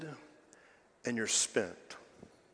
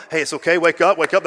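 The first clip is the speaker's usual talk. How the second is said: louder, rousing call to the drowsy; playful wake-up call; 8 dB louder, faster than usual. Pretend. Preacher to sleepy congregation, playful wake-up call. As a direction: jokingly rouse the dozing congregation, raising your voice.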